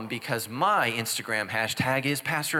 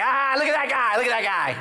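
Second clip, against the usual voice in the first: much higher, louder jeering; mocking crowd in hell; 9 semitones higher, 4 dB louder than usual. Damned crowd in hell mocking the pastor, loud high jeer. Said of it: "Everybody's like".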